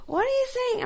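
cupping an ear, not hearing a thing; confused old passenger. Retold or imitated. Deaf old passenger again.

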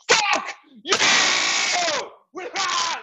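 shrieking high defiant yell. Retold or imitated. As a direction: scream it back in outrage. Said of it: "And America's like".

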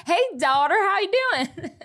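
high, mock hearty dad; hammed-up dad greeting. Host playing the dad arriving on campus with a longhorn, hammed-up greeting. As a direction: call out a jokey fatherly hello, pitched high. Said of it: "to campus and say".

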